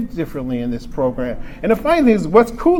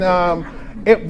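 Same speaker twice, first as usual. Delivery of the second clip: usual speaking voice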